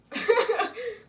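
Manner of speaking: high, loud laugh; burst of laughter. Real, not acted. Friend laughing after gushing over the book, high and loud. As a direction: let out a big giggle.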